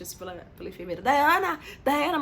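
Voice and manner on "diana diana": louder sharp calls of a name; calling out. Retold or imitated. Nurse wakes the patient after surgery, calling loudly. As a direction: call her awake briskly.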